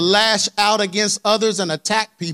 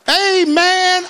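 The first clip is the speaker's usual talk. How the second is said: usual speaking voice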